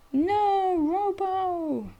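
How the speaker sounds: high, loud cry of despair; anguished shout. Acted. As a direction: shout out in shock. Robot gets eaten.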